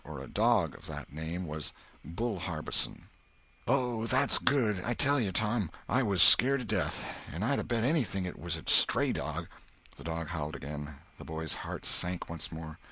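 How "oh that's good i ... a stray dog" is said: put-on boy telling his friend he was scared, relieved. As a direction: let the fear drain out. Huck relieved.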